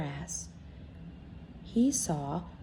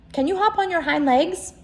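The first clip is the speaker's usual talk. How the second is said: higher, louder challenging rabbit; furry rabbit; 10 semitones higher, 6 dB louder than usual. Acted. Wild rabbit challenging the toy, high and loud. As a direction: test him with a sly question. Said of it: "asked the furry rabbit".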